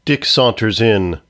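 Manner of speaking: normal talking voice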